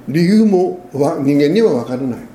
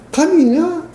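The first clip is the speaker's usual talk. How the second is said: emphatic; 12 semitones higher, much slower than usual. Real, not acted. Preacher names God emphatically.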